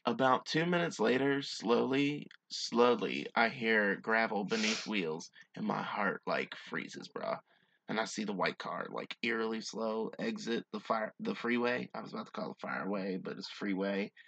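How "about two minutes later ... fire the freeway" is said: low, measured storytelling read; hushed-down, deliberate campfire-tale delivery; story narration. A narrator's reading. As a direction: read it out slowly, building suspense. Reads a story aloud.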